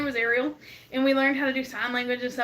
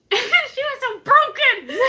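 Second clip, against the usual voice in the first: higher and louder, slow, breaking into laughter; laughing; 10 semitones higher, 6 dB louder, much slower than usual. Real, not acted. Laughing about her broken fake baby, laughing slowly. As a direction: drag it out while cracking up.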